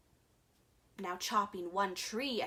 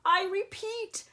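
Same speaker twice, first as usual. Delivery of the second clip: high, raised Lorax cry; high insistent Lorax; 9 semitones higher, 4 dB louder than usual. A narrator's reading. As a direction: call out insistently, pitched up. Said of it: "cried the Lorax".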